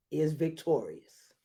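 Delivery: ordinary delivery, nothing marked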